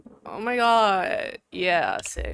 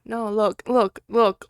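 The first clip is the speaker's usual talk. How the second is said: ordinary delivery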